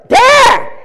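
sudden high shout of Dad; child's shout. Retold or imitated. Boy yelling for his dad in panic, loud and high. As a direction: yell for your father in alarm. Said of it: "And Jonathan goes".

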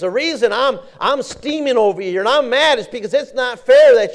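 high, loud, steaming complaint; frustrated Martha. Acted. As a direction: vent like a cook banging pots. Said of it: "she said".

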